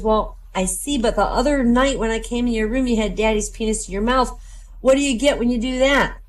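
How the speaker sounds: put-on high, innocent child voice; little girl voice. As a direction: ask it like a curious little girl. Daughter voiced.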